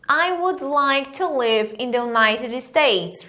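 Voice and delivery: I would like to live in the United States at half speed; slow it down for learners to repeat; teacher modelling a line for the class to echo; slow drill sentence. Shown as a demonstration.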